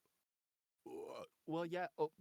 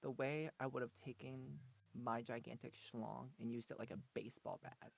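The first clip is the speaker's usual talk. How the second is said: very soft, lower-pitched voice; 4 semitones lower, 10 dB quieter than usual. Pretend. Guest answers a question about Punchy, soft. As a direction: drop to a hushed, low murmur. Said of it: "Now you're all soft".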